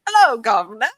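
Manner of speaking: mock British "Hello, governor", loud and bouncy; fake British accent. Backstage at Universal, hammed-up fake British accent. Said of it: "do not fake a British accent".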